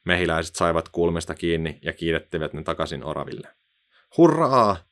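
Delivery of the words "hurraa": loud, high hurrah; cheering. A narrator's reading. Apple tree cheers in chorus. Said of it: "kuului kuorossa".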